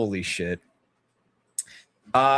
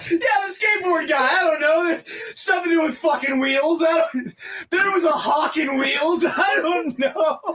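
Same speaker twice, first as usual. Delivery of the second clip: laughing shout; 12 semitones higher than usual. Real, not acted. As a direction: yell it while cracking up. Still cracking up over mix-up.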